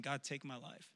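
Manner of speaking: very soft, despairing plea; barely voiced surrender; understated cry to God. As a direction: act out a hushed, beaten prayer. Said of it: "he's crying out to God".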